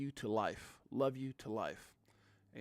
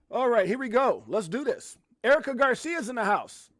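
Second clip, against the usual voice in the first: high, loud show-host hype; hyped-up announcing; 10 semitones higher, 10 dB louder than usual. Real, not acted. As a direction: kick off the show with a big shout-out. Hyped show opener, greeting sister.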